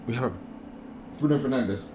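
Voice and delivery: ordinary talk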